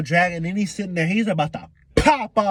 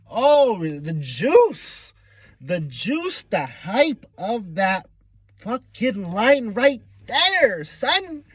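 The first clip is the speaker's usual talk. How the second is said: slow, drawn-out, higher hype; 5 semitones higher, much slower than usual. Real, not acted. Fan raving about the line to viewers, hyped. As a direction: savor every word of the hype.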